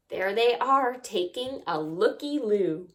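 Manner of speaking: ordinary delivery, nothing marked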